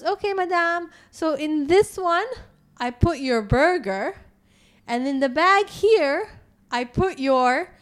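lightly imitated Filipino accent, slow and careful; polite waitress, a touch of Filipino accent; slight Filipino accent. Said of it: "obviously with a slight Filipino accent".

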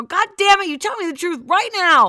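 high, loud furious yell; yelling at the cheating boyfriend on the phone; angry shout. Retold or imitated. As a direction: demand the truth at full volume. Retold fight with cheating boyfriend.

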